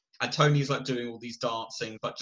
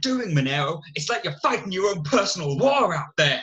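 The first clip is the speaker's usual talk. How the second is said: lightly voiced scolding teacher, higher; a hint of a teacher telling off a pupil; 5 semitones higher, 4 dB louder, faster than usual. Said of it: "like a teacher".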